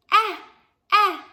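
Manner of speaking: ah said short and loud like the short e in "eggs"; short e sound. Shown as a demonstration. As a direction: bark out a crisp "ah" for eggs. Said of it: "What letter makes the ah sound?".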